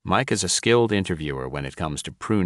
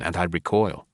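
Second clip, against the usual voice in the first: ordinary delivery, nothing marked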